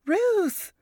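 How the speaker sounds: high, urgent call from afar; Varys calling out. A narrator's reading. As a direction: call her name in a panic. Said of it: "Varys's voice came from a distance".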